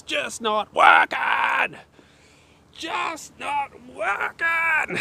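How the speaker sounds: drawn-out, high, loud mock cry of frustration; hammed-up despair at the broken tech; mock frustrated wail. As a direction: wail it slowly like the world is ending. TTS keeps failing, frustrated wail.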